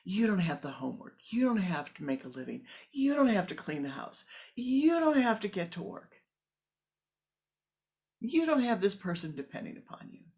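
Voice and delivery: a frustrated woman silently ranting at the yogi; listing grievances. Acted. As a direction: pile up the complaints until they burst. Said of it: "mentally she listed everything".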